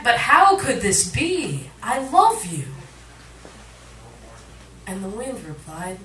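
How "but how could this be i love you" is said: higher, louder and slower, calling across the distance; called back. Acted. Sand dune calling back to the wind, higher and louder. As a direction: plead loudly after the departing wind. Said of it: "the sand dune called back".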